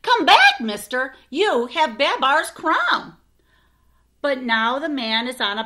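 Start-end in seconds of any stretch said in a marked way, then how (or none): 0.0-3.2 loud high yell, slowing on the last words; calling out. Acted. Arthur yelling after the mustache man, loud and high. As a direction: yell after someone getting away. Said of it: "He yells".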